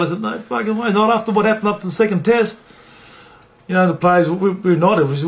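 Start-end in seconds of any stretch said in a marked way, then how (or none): 0.2-2.6 retold protest pitched well up; acting out a high-pitched objection; indignant refusal. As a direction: refuse point-blank, voice climbing high. Player refuses coach's order.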